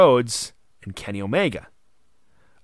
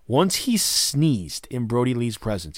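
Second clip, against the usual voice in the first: ordinary delivery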